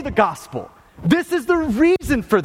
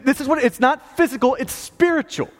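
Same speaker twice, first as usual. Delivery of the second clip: ordinary talk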